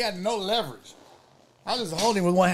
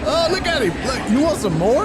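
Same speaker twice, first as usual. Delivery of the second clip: mock taunt; 5 semitones higher, 5 dB louder than usual. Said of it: "That motherfucker said".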